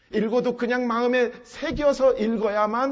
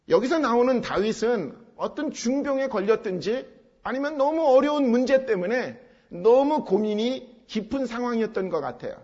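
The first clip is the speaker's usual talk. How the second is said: normal talking voice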